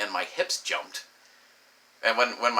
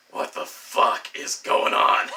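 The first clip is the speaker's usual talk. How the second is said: loud, slow, voiceless strain; strained shock; 6 dB louder, slower than usual. Acted. Man grabbing the sheets in shock, strained and loud. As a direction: force it out slowly through a straining throat.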